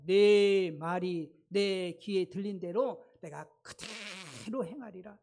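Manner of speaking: slow and weighty divine pronouncement; 느리고 장엄한 하나님의 음성; voice of God. Retold or imitated. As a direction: deliver each word slowly like a solemn decree. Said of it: "그래서 그런 말씀하여요".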